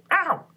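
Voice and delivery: high, loud yelp of pain. A narrator's reading. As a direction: yelp as if pinched. Dormouse pinched.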